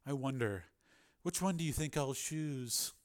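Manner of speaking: very soft, musing tease; hushed, playful menace; soft taunting. A narrator's reading. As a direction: wonder aloud, quietly toying with him. Dominant figure teases captive.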